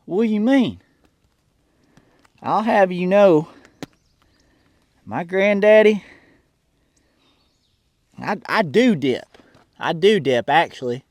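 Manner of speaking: put-on country-boy character with a Southern accent.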